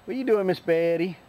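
usual speaking voice

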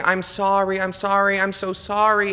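high, loud mock sobbing apology; hammed-up crying cashier pleading sorry; mock bawling. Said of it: "she's bawling and she's saying".

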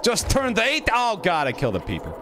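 high-pitched startled outburst; high yelp of alarm. Real, not acted. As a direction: cry out in sudden alarm, pitch dropping. Sudden mishap in game.

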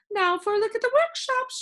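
high, loud eager call; the sound keeper sweeping him along, calling out; cried out by the sound keeper. Acted. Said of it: "she cried".

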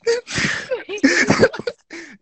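squealing high laugh. Real, not acted. Streamer laughing at his own fake crying, high squeal.

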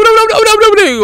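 high yelping oblik; excited squeal. Real, not acted. Gamer squealing at a strange creature. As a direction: squeal the word out in surprise.

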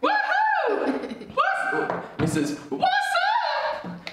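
very high, giggly drawn-out calls; hammed-up high-pitched whooping; silly squeal. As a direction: whoop it playfully, stretching the vowels. Playing with the silly name.

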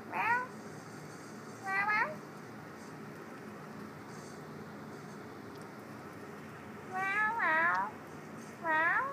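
high, rising meows to lure a cat. Pretend. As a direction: meow like a kitten, calling it out. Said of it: "I was trying to get her to make noise".

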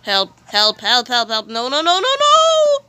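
panicking, begging for help; scared. Real, not acted. That was scary.

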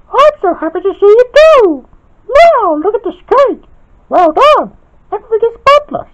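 loud falsetto villain voice; very high, mock-sweet character; Evil Spinel. A narrator's reading. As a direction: gush in a piercing falsetto. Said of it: "said Evil Spinel".

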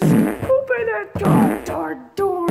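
high, loud, slow demand to open up; demanding yell. Acted. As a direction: bang on the door with your voice.

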